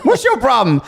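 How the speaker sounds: high, loud confrontational dog voice; tough Baltimore dog. Pretend. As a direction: shout it like a scrappy Baltimore tough. Same dog voice, laughing.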